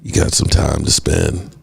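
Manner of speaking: low, seductive crooner pickup. Acted. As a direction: sweet-talk a partner in a deep, unhurried voice. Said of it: "with that voice".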